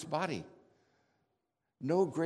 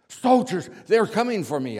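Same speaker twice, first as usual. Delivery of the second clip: much louder and higher, startled shout; panicked outburst in a dramatic retelling; alarmed cry; 7 semitones higher, 15 dB louder than usual. Acted. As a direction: cry out in sudden alarm at the danger. Sleeper fears soldiers at door.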